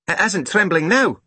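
put-on high little-boy voice; unimpressed small boy. A narrator's reading. Small boy doubting his sister's claim, matter-of-fact.

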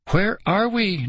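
high, lightly voiced choked question; a hint of frightened choking. A narrator's reading. Girl in the dark tunnel, mild choked fear. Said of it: "Nicole choked out".

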